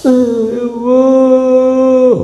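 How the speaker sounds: loud, very high drawn-out mock wail; fake crying. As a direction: pretend to bawl, stretching it out. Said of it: "maka pura-puralah menangis". (pura-pura menangis meraung)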